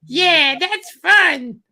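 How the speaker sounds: high, slow, loud falsetto; cool chick voice. Acted. Girl agreeing to the play place, mock girl voice. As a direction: cheer along as a mock girly date. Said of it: "she's like".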